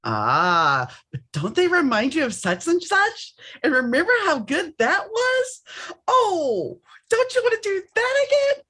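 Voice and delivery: high, sly, coaxing whisper-like tease; tempting ego voice. Acted. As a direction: play the ego luring you back to an old flame. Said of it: "your ego's sitting there going".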